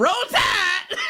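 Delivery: high, loud Alabama team cheer; cheer shout. Real, not acted. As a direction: belt it out like a cheering fan.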